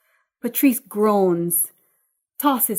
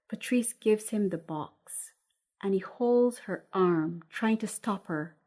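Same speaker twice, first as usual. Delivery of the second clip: plain reading voice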